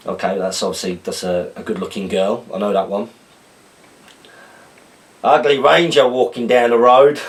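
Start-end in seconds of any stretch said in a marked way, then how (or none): 5.2-7.2 loud, laughing Australian accent attempt. Reading slang to the channel, laughing Australian accent. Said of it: "decipher these Australian slang terms slash words in an accent".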